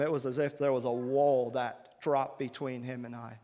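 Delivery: ordinary talk, nothing marked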